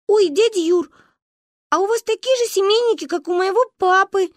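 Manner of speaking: put-on high, loud little girl voice; touched little girl. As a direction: coo like a charmed little girl. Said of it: "умилилась Манюня".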